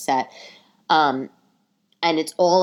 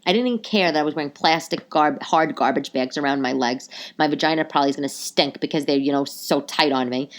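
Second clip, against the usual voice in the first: usual speaking voice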